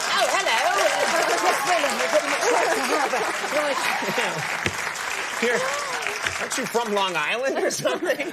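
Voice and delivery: high, loud mock posh English accent; fake British accent. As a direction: ham up a put-on English accent. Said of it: "people like [name] who sort of been like".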